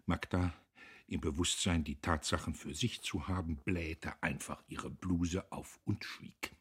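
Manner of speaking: ordinary delivery, nothing marked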